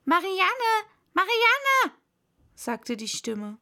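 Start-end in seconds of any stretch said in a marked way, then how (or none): 0.0-2.0 high, loud summons; impatient master's shout; calling out. A narrator's reading. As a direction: call sharply for the maid, louder the second time. Rabbit calls for his maid.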